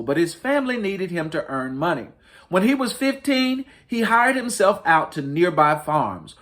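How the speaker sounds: lightly lifted storybook read-aloud; reading to children. Acted. Librarian reading to families, mild storytelling voice. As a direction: tell it gently like a picture book. Reads picture book aloud.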